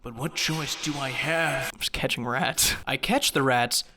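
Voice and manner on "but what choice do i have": hushed grim resignation; hammed-up hero accepting fate; mock dramatic hero. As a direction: sigh it out like a tragic hero. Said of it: "He's, like, sacrificing his arm".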